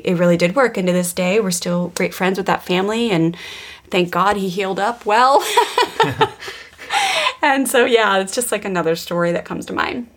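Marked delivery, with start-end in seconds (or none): 5.5-6.5 short high burst of laughter; real laugh. Real, not acted. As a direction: laugh it off, much higher. Laughs at her own story.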